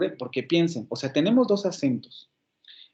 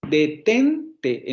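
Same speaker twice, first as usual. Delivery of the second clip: "Detente" with TEN punched and stretched; stress on second syllable. Shown as a demonstration. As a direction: hit the middle syllable of "Detente" hard. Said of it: "en la segunda sílaba".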